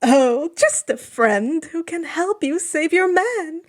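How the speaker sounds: high, coy trickster god. Acted. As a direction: tease him sweetly. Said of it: "Hermes says coyly".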